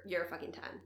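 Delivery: ordinary talk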